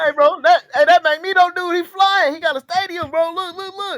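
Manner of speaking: high-pitched, urgent mock alarm; hammed-up high-voiced eyewitness; excited bystander. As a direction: alert your buddy in a high, frantic voice. Bystander pointing out flying Magneto.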